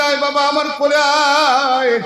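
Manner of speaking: high, drawn-out beckoning call; Umme Ayman calling. Acted. Nursemaid calling the orphaned boy to her lap, drawn-out high call. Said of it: "Umme Ayman ডাক দিয়ে বলে".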